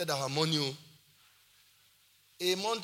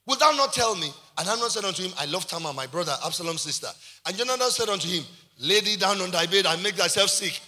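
loud, projected Bible reading; scripture reading; 2 semitones higher, 8 dB louder than usual. Real, not acted. Preacher reading Jonadab's question to the congregation, loud scripture reading. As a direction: read the verse out to the whole hall.

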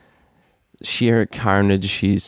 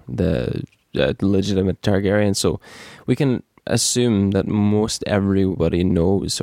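normal talking voice

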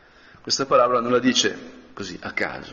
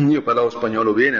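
usual speaking voice

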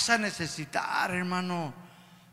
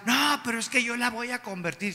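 higher-pitched insistent objection; mock protest; 4 semitones higher than usual. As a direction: imitate a stubborn believer protesting. Acted believer objecting to advice.

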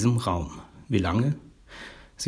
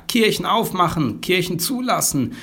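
mock crowd calls; 7 semitones higher than usual. Church members calling over one another, mild higher-pitched calls. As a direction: toss out rival slogans on a raised pitch. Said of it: "rufen die Leute durcheinander".

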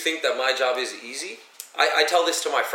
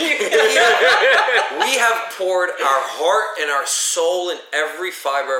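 passionate; 6 semitones higher, 7 dB louder, slower than usual. Real, not acted. Passionate claim amid laughter.

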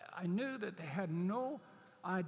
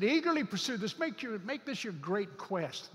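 "Eagerly" high and loud; emphatic stress; 4 semitones higher, 5 dB louder than usual. Shown as a demonstration. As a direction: burst out on "Eagerly pursue this". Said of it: "emphatic scripture reading".

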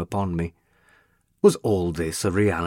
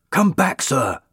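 high, loud shout of warning; fireman's cry; 7 semitones higher, 5 dB louder than usual. A narrator's reading. Fireman yelling at the man rushing into the fire, loud high shout. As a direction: call him back from the burning house at full voice. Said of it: "cried a fireman".

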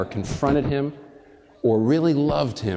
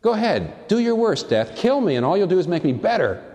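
higher, daring challenge to death; bold dare; 7 semitones higher than usual. Acted. Preacher daring death to do its worst. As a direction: taunt death with a raised voice.

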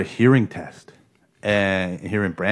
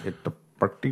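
soft, lightly imitated Indian accent; 2 semitones lower, 10 dB quieter, faster than usual. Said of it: "heavy Indian accent".

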